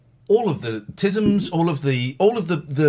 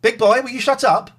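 telling the dog off; 8 semitones higher, 4 dB louder than usual. Real, not acted. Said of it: "Getting on my nerves now".

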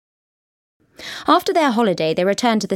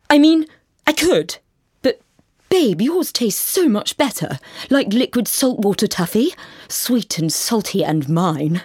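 put-on savoring boyfriend; 2 semitones higher than usual. A narrator's reading. Carl savoring her tears.

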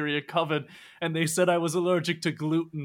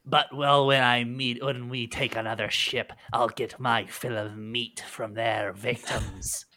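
dog-woman pirate explaining her appetite; feral werewolf woman; 5 semitones lower than usual. Acted. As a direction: relish it like a hungry wolf.

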